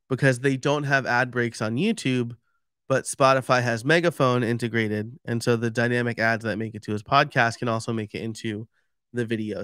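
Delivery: ordinary talk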